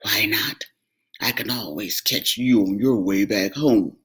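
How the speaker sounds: low, deep troll voice; troll reasoning to himself; scheming troll. Acted. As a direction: plot the catch.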